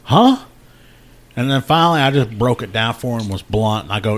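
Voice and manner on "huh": very high, loud startled "Huh?"; a shocked, disbelieving yelp; stunned huh. Retold or imitated. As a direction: brother caught off guard, yelps in shock. Brother stunned by the news.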